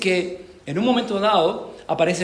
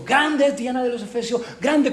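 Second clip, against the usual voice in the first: pitched up high like a frenzied mob; fervent crowd cry; 6 semitones higher than usual. Retold or imitated. Preacher imitates the fevered Ephesian crowd, a high mock cry. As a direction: raise it high like a chanting crowd, with a rising end. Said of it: "la gente está gritando enfervorecida".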